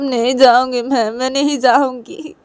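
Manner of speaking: high, loud tearful begging; crying plea. Acted. Rui begging Piu to let her stay, crying. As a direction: wail and beg to be allowed to stay. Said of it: "रुई रोते रोते बोलती PU को".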